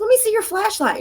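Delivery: high urgent demand; urgent request. Retold or imitated. Demanding the friend's flashlight in the dark, urgent.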